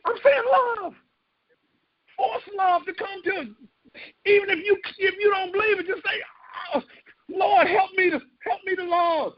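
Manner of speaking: high, crying-out sermon climax; preaching at full force. Acted. Pastor rousing the congregation, high impassioned cry. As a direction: cry it out from the pulpit.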